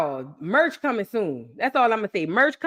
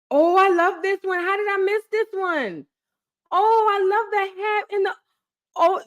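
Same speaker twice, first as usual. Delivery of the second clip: excited; 10 semitones higher than usual. Real, not acted. Gushing over new photos.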